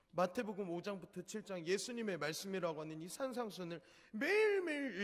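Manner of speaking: high, pleading cry to heaven; crying out to God. Retold or imitated. Preacher crying out to God on the mountain, anguished. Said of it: "가슴을 치며 통곡했었습니다".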